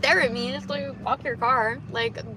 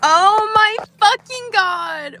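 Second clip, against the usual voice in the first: shocked outburst; 7 semitones higher, 13 dB louder, much slower than usual. Real, not acted. Shocked at something on road.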